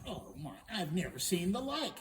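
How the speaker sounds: loud, astonished princess; amazed princess. Acted. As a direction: marvel at "I've never seen the like". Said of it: "she's going".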